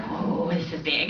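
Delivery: soft, slow, laughing wonder; laughing amazement. Retold or imitated. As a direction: breathe it out through a laugh. Amazed by the money.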